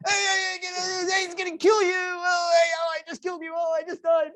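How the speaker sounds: dad acting out his son yelling at a game at 4 am; imitating son shouting. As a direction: yell at the screen like a gamer at night.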